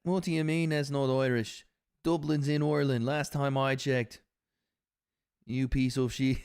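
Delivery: a hint of a put-on Irish accent; mock Irish accent. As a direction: play the offended Irishman in an Irish lilt. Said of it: "the accent".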